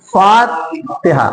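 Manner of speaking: फातिहा slowly, फा held long, तिहा after it; long alif. Shown as a demonstration. Teacher demonstrates the alif, फातिहा with long फा. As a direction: stretch फा in फातिहा, keep ति short. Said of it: "यहाँ पे भी अलिफ आ गया".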